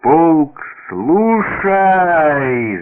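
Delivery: loud, high parade-ground command; long ringing order to a regiment; military shout. A narrator's reading. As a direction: bellow it with the last word drawn out. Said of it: "закричал солдат".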